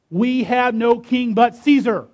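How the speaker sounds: loud, high, emphatic retort; defiant declaration. A narrator's reading. Chief priests answer Pilate in defiance.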